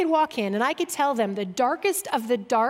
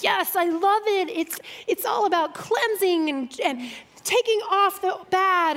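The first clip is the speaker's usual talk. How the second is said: imitating Susie; 6 semitones higher than usual. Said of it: "and she's like".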